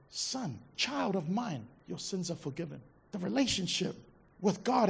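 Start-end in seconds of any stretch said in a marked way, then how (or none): 0.1-2.8 soft, hushed, tender father's words; almost whisper it, like a loving father. Acted. Jesus speaking gently to the paralytic, tender and hushed.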